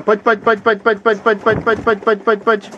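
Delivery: high, loud sing-song call to the birds; calling the pigeons. Real, not acted. Breeder calling his pigeons in, with high loud chanted calls. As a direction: call them home to the loft, high and loud. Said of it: "zawołałem".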